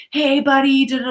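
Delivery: put-on high, loud chummy greeting to a kid; friendly man. Stranger greeting the little boy, chummy. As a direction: play the overly friendly stranger. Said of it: "and he was like".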